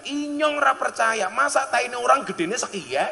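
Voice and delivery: Ngapak dialect, incredulous shout; Ngapak accent shout. Retold or imitated. Ngapak man yelling in disbelief, Ngapak accent. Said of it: "langsung teriak".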